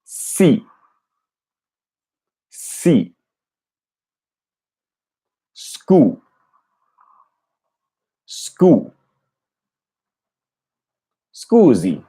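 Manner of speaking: echoing the Italian tape syllable by syllable; repeating Italian. Shown as a demonstration. Learner repeating Pimsleur audio, careful Italian scusi. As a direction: copy the Italian speaker's scusi closely, Scu- then scusi. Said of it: "trying to make it sound exactly like them".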